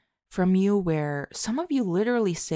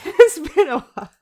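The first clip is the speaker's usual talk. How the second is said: laughing; 12 semitones higher, 13 dB louder than usual. Real, not acted. Laughing at own forgetting.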